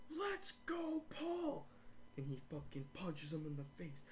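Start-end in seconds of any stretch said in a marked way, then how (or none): 0.1-1.7 very high imitation of cheering fans; mock crowd cheer. Fans backing Paul Heyman, high mock cheer. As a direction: cheer him on in a squeal-high voice. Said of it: "and everyone's like".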